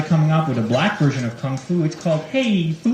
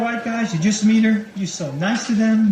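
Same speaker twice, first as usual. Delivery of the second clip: usual speaking voice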